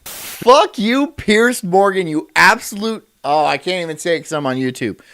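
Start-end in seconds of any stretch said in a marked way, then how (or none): 0.1-3.1 loud, high angry tirade; angry outburst. Real, not acted. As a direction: let rip at him, loud and raised. Ranting at Piers Morgan on stream.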